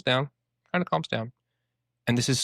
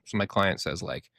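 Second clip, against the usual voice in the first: ordinary delivery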